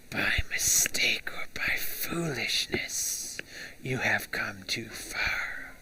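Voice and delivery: slow, dry rattling whisper of death; dark whisper behind you. Acted. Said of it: "that whispers out with a dry rattle".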